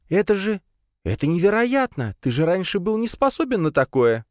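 high-voiced pet in disbelief; amazed exclamation. A narrator's reading. Spider marvelling at master's new power, amazed. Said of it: "воскликнула паучиха".

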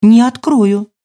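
high-pitched wary refusal; distrustful, pitch jumping up; suspicious. A narrator's reading. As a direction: refuse with guarded firmness. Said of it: "Голос снова стал подозрительным".

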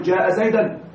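زيدا pronounced "Zaydan" as a wrong example; wrong accusative ending. Shown as a demonstration. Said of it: "لا تقل".

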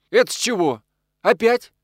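annoyed husband's challenge; cross. A narrator's reading. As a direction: call out crossly, pitch jumping up. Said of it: "сердито окликнулся Косяков".

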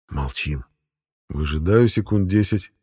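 plain reading voice

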